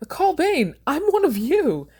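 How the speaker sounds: high, loud panicked pleading; desperate plea. Acted. Stryver begging the court, desperate and panicked. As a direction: beg for your life. Prisoner pleading for his life.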